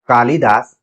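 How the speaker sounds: ordinary talk, nothing marked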